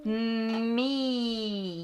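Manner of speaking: the whole line dragged out very slowly; slow and stretched. Shown as a demonstration. A mom cueing her daughter to say Me together slowly. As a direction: drawl it out for a small child. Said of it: "say the words with me".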